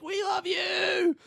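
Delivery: very high, slow, drawn-out squeal; adoring groupies. Acted. Women at the fence squeal slowly at the second man. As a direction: swoon over him.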